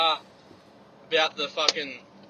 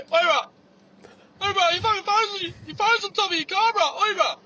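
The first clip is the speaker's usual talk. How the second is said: loud shouted warning across a car park; calling out; 6 dB louder than usual. Retold or imitated. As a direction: yell it from the bus stop. Junkie yelling from bus stop.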